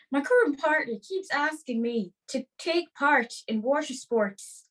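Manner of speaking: measured put-on Irish letter reading; Irish accent. Host reads a listener's water sports dilemma, put-on Irish accent. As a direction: read the worried letter in stage Irish. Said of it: "Your accent is sick".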